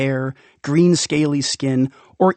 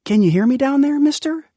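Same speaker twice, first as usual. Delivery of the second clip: high, lightly voiced kid calling down; calling child; 11 semitones higher, faster than usual. Child calls down the rain drain, high voice. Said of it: "a little bit louder this time".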